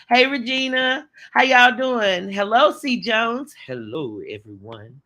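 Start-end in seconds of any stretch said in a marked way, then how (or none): 3.6-4.2 hello dropped very low and soft; mock deep sexy Hello. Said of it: "That's how I think your voice is". 4.3-5.0 very low, soft, deep sexy voice; deep sexy voice impression; imitating a deep voice. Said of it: "That's how I think your voice is".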